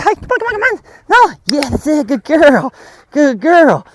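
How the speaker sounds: high, excited coaxing and praise; exciting dog talk. Shown as a demonstration. Trainer calling the dog back, happy excited voice. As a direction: squeal the call, then gush praise. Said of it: "trying to be exciting and cooler".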